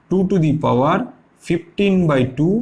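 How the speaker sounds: dragged-out dictating pace; slow dictation. Shown as a demonstration. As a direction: say it slowly so students can write it down. Teacher dictating while writing.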